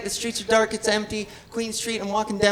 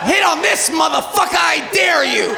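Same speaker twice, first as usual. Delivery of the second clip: hammed-up dare; 5 semitones higher than usual. Comic daring catcallers, mock-aggressive shout.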